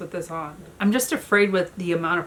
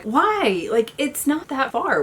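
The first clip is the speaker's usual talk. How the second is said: higher, louder incredulous objection; retold protest; 7 semitones higher, 4 dB louder, slower than usual. Woman retelling her objection to his parking plan, incredulous protest. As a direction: push back with a high, exasperated why.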